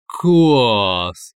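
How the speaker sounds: long, loud drawn-out exclamation. A narrator's reading. Man delighted by his inner voice, exclaiming. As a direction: exclaim it in delight, stretching the vowel. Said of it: "воскликнул он".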